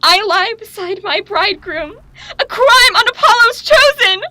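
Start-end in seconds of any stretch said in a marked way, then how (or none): 2.4-4.2 very high, loud ringing curse; prophetic shout. Acted. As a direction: hurl the curse up to the heavens.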